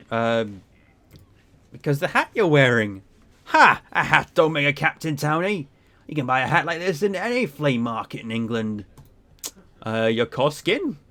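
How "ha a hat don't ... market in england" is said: scoffing sea captain. Acted. Captain mocks a townie's guess, scoffing. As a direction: scoff like a seasoned sailor at a townie.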